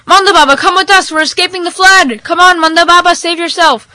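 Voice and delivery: high, loud pleading shout; urgent yell. Acted. Car passengers urging him to escape, urgent yell. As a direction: shout to hurry him along. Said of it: "Everybody who was in the car yelled".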